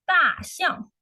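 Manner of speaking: 大象 said high and clear, falling on 大; 大象 as a teacher's model; clear model word. Shown as a demonstration. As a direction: model 大象 for the class, high and bright. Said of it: "So 大 means big".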